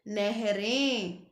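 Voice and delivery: नहरें said very slowly, nasal ending clearly drawn out; slow nasal plural. Shown as a demonstration. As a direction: stretch नहरें so the nasal ें rings. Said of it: "एक ही मात्रा के बाद". (नहरें धीरे-धीरे, अनुनासिक साफ़ सुनाते हुए)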